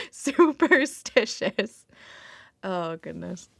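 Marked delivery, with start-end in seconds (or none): 0.1-1.9 laughing. Real, not acted. Laughing through the word again.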